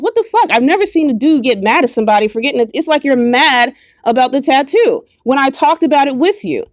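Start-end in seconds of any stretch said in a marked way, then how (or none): 0.0-0.5 a high, shocked what-the; shocked disbelief. Retold or imitated. Recalling his blocking her, mock outrage. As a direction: pitch leaps way up in disbelief. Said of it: "I'm like".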